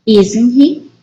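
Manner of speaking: "Isn't he" lifting on "he"; rising intonation. Shown as a demonstration. Teacher checking He's from France, rising intonation. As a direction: end "Isn't he" on an upward questioning note. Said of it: "the intonation goes up".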